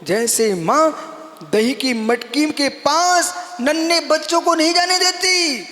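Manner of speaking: normal talking voice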